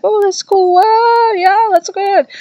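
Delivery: high, airy mock student voice; mock chill college kids. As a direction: sound like a laid-back kid saying cool. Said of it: "They're like".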